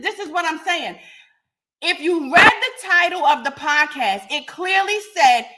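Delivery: normal talking voice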